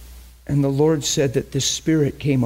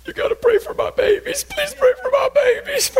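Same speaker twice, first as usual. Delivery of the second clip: very high, wailing mock sob; hammed-up tearful plea. Mother begging pastor to pray for her girls, exaggerated crying. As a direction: squeal it like a hysterical begging mother.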